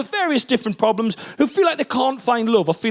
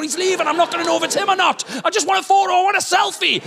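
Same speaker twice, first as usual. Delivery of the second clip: much higher, rising to a shout; frantic panic; 11 semitones higher than usual. Retold or imitated. Starstruck husband pleading with wife in restaurant, frantic. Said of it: "I'm freaking out".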